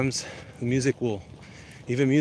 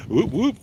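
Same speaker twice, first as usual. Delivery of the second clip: high, loud double whoop; playful whoop; 11 semitones higher, 7 dB louder than usual. Pretend. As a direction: whoop it out in fun. Reads a viewer's whoop aloud.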